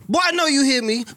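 high-pitched scolding imitation; mock stern call. Adult to a boy who keeps walking, high-pitched mock scolding. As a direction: call out a child who is ignoring you. Acts calling an ignoring child.

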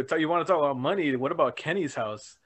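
usual speaking voice

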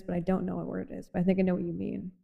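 normal talking voice

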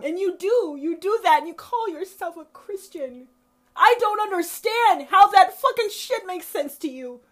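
high, loud indignant yelling; furious shout. Real, not acted. Yelling at hypocritical Christians, high and loud. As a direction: yell it out, incredulous.

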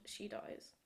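dropped to an unvoiced whisper. Shown as a demonstration. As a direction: let it fall to a grim whisper. Ominous refrain in ransom note.

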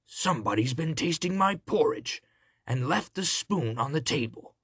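great big growly bear voice; papa bear's big growl; growling father bear. A narrator's reading. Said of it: "growled the father bear in his great big voice".

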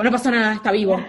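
loud, quick "No pasa nada, está vivo" to calm yourself. Retold or imitated. Parent reassuring themself after a child's fall, loud quick reassurance. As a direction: bark out the reassurance to calm your own nerves.

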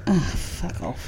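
quiet disgusted aside; muttered. Real, not acted. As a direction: mutter it under your breath. Annoyed aside, voice drops.

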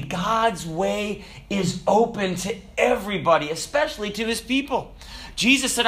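high-pitched, impassioned sermon delivery; fervent preaching. Real, not acted. Preacher assuring the congregation that God provides, fervent and emphatic. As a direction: preach it with rising urgency and punched key words.

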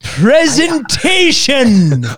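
drawn-out punchline. Acted. Supervillain joke punchline.

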